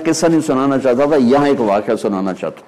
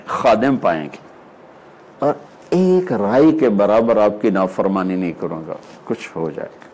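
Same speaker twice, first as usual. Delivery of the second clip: normal talking voice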